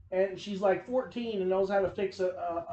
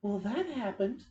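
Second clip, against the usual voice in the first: normal talking voice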